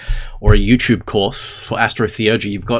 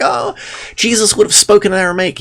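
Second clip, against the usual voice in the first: mock know-it-all; 10 semitones higher, 4 dB louder than usual. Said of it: "everyone's like".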